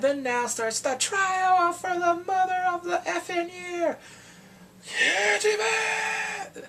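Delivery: excited outcry. Real, not acted. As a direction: cheer the trial on with a cry. Trial opens, she cries out.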